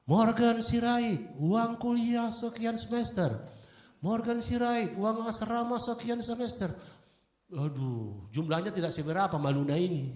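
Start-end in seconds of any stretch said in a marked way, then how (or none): 0.1-6.7 high, slow roll call of names; slow mock roll call; reading out debtors. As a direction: drag out each name to shame them. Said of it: "Dari Ibu Lydia Hermanto".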